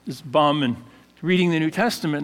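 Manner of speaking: ordinary delivery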